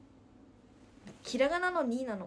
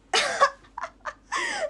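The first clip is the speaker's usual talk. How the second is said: high laugh; 12 dB louder than usual. Real, not acted.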